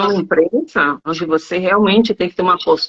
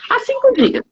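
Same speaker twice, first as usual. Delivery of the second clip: student quoted. Retold or imitated. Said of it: "Eu falo assim".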